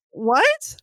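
lightly voiced startled what, rising; thrown off. Retold heroine caught off guard by barista, startled rise. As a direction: lift it sharply, caught off guard. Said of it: "thrown off".

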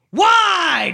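high, loud yelled question; Shia LaBeouf. Retold or imitated. Actor leaning out to the driver, yelling.

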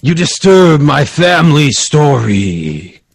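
slow, drawn-out ghostly boom; an echoing voice from the painting, slow and booming; booming crazy voice. Acted. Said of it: "crazy voice".

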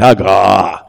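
Hagah said loud and low, HAH-gah, falling; Hebrew word hagah. Shown as a demonstration. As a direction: Hagah with breathy h, stressed first syllable. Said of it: "you can say it".

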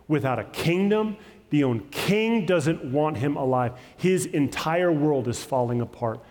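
normal talking voice

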